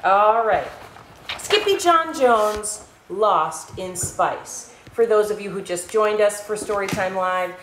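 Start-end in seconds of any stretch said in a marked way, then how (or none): 1.4-4.7 slow, drawn-out title read; title announcement. Acted. Host announcing the book title to kids, slow and showy. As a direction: announce the title to the audience slowly.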